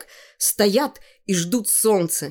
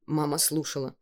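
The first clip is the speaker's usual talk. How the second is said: ordinary delivery, nothing marked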